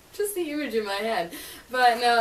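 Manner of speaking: ordinary talk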